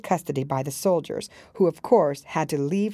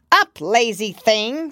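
loud, slow bossy command; scolding Queen; 7 semitones higher, 7 dB louder, slower than usual. A narrator's reading. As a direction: order it like a shrill queen.

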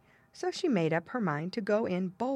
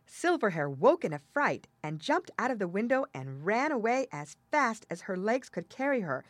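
plain reading voice

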